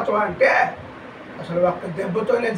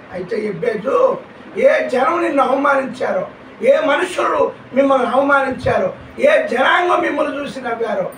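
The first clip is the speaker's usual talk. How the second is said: a building, louder and higher divine proclamation; God's loud promise; 4 semitones higher, 4 dB louder than usual. Acted. God answering Ebbeju's weeping, loud rising proclamation. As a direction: thunder the promise out over the town. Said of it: "అన్నాడు దేవుడు".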